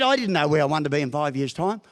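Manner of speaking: usual speaking voice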